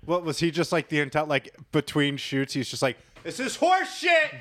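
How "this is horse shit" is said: high, drawn-out shout of outrage; hammed-up, slow high-pitched bellow; mock angry yell. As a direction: holler it like a fed-up cop. Voices the cop yelling between shoots.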